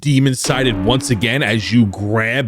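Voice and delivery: epic, building scene narration; dramatic narration. A narrator's reading. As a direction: narrate the big magic blast. Dramatic game master narration.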